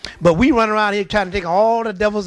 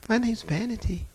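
a soft, sweet, innocent little voice; sweetly innocent, hiding the demon; soft and sweetly demure; 4 semitones higher, 9 dB quieter than usual. Retold or imitated. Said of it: "She sounded just as sweet and demure".

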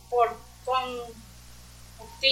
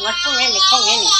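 higher, louder quoted correction, rising on N; insistent retold correction; 4 semitones higher, 5 dB louder, faster than usual. Congregant retells being corrected, raised insistent voice. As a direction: imitate someone insisting on a correction.

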